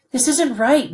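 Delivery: high, loud cry of protest; anguished protest. A narrator's reading. Son protests to his father, high anguished outburst. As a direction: push back with all your hurt.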